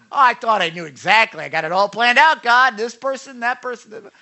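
loud, rising mock self-assurance; cocky younger self. Mimicking his younger self's confident prayers.

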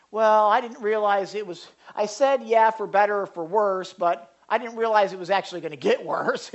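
high, loud comic protest; mock excuse-making. Spouse excusing broken marriage vows, mock protest. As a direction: play a spouse wriggling out of the vows.

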